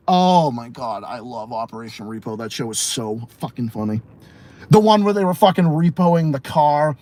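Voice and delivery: normal talking voice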